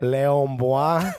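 "Léon Bois" said slowly, stretched, with mock French sounds; drawn out as if showing off French; playful fake French phrase; mock French words. Shown as a demonstration. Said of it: "that means the dude's drinking".

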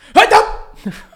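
shrieking burst of laughter; high loud laugh. Real, not acted. Laughing at mouse story.

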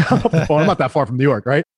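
normal talking voice

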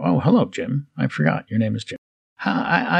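ordinary talk, nothing marked

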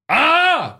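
loud, high yell of "ah"; real high-pitched outburst; sudden shout. Real, not acted. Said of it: "What was I shouting for?".

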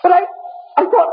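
stunned. Acted. Stunned disbelief.

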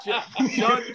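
ordinary talk, nothing marked

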